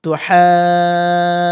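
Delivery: drawn, chanted হাজুনা; long madd. Shown as a demonstration. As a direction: stretch it slowly in recitation. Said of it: "demonstrates pronunciation".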